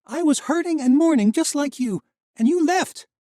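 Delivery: high, loud furious accusation; angry. Acted. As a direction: tear into him, fast at the end. Misha's anguished anger.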